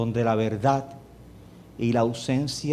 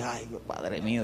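relieved sigh; 3 semitones lower, 9 dB quieter than usual. Retold or imitated. Pastor relieved, sighing.

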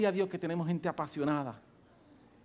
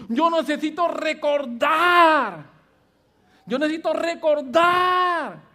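full-force preaching; 6 semitones higher, 9 dB louder than usual. Acted. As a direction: preach it harder and harder. (predicación in crescendo, cada vez más fuerte)

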